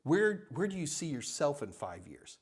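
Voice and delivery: quiet, teasing counter-question from a candidate; interviewee turning the question round. Retold or imitated. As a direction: toss the question back to tease them. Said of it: "the person who's being interviewed says".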